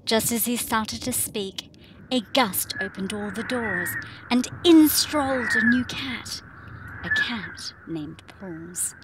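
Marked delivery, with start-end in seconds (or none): none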